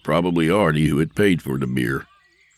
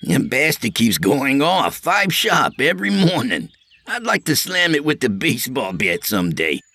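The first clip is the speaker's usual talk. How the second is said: high, nervous joking voice; Artie's voice; 8 semitones higher than usual. A narrator's reading. Artie joking to Sam, high and nervous. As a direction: pitch it up as jittery Artie.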